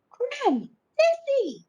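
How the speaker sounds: put-on very high excited cry of a boy; excited shout. A narrator's reading. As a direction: call out to Gran, thrilled. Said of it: "Finlay shouted".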